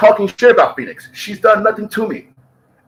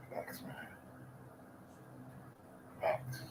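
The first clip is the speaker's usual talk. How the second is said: whispered agreement; 32 dB quieter, slower than usual. Real, not acted. Agreeing murmur off to the side.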